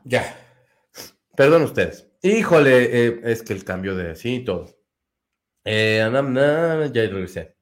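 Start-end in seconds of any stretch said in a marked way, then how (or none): none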